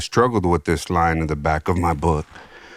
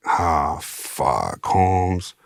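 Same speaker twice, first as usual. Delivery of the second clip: low sinking mutter on fuck; mild mock dismay; dismayed groan; 4 semitones lower, much slower than usual. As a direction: deflate as you realize you're stuck. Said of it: "I'm like".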